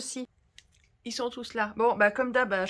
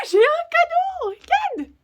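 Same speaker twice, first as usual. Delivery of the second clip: very high, loud, drawn-out squeal of delight; thrilled, high-pitched and stretched out; excited squeal; 11 dB louder, much slower than usual. Real, not acted. Delighted at finding a gift.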